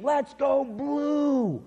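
high, drawn-out mock yell; imitated screaming cheer. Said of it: "this lady starts screaming".

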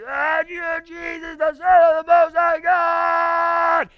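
high, loud drawn-out scream. Acted. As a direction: scream it like a madman.

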